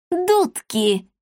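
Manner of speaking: high, defiant character exclamation; old man's cry. A narrator's reading. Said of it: "крикнул старик". (упрямый выкрик старика)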